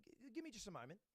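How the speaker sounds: quiet, halting self-talk of airline staff; talking to herself. Retold or imitated. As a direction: say it half to yourself. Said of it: "she starts talking to herself".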